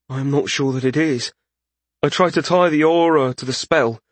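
lightly voiced mage, a touch of worry; worried mage. A narrator's reading. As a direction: explain uncertainly in the mage's character voice.

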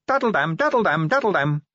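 high sung nonsense; singsong daddle-dam. Acted. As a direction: chant it lightly. Imitated tune or noise.

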